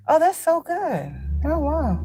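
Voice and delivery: high, soft fake admiration; fake impressed. Faking interest in a bragging acquaintance. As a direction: feign being impressed, breathy and high. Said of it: "I'm like".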